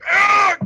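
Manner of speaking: sudden loud, high yell; acted scream. Man being thrown off the boat, loud acted scream. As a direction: scream like someone hurled away. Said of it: "as you hear".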